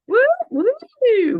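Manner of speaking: high loud cheering whoops; excited whoops. Real, not acted. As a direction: whoop it up for the crowd. Hyping up live audience.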